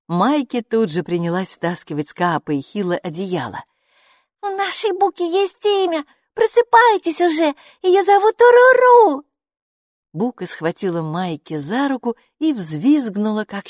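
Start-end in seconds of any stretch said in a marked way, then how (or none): 4.4-9.2 high, louder calling to wake them; excited loud girl. Acted. Майки, маленькая девочка, громко будит Каапа и Хилу. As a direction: wake the sleepers with the news. Wakes others.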